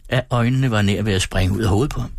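usual speaking voice

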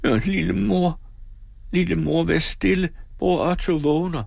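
put-on high, pleading little-girl voice; small frightened child's voice; anxious, tearful child. A narrator's reading. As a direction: beg her anxiously to hush. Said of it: "grædende af angst".

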